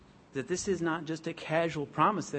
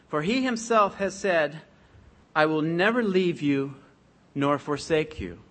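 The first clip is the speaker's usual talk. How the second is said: louder, declaiming Bible reading; scripture reading; 5 dB louder than usual. Acted. Preacher reads Hebrews to the church, proclaiming. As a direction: proclaim the verses to the congregation.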